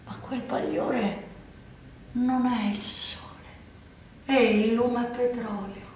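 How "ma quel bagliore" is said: ordinary delivery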